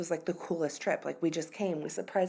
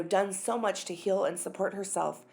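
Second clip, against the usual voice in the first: normal talking voice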